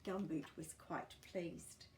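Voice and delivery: ordinary delivery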